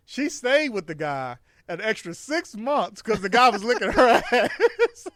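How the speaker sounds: high, loud and drawn out, breaking into laughter; laughing through it. Real, not acted. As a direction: laugh your way through the punchline. Punchline of his own racy story.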